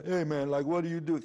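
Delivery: mock New York hipster greeting; New York guy. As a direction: toss it off like a New York club kid. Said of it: "all these different sounds in New York".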